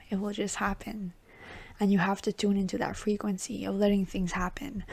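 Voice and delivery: hushed, soothing whisper; gentle whispered guidance; soft whisper. Acted. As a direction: let it fall to a whisper, calm and knowing.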